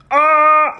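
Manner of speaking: high, loud pained "ah"; masculine yell. Retold or imitated. Imitating a movie character yelling in pain, masculine yell. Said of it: "It's such a masculine yell".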